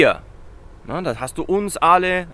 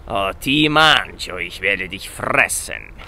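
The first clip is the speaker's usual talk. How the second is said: mock monster; 8 semitones lower, slower than usual. Joking threat to eat student.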